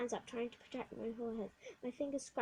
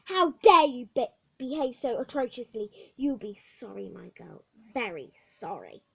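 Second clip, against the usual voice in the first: put-on matron scolding the unruly girl; furious matron; 12 dB louder than usual.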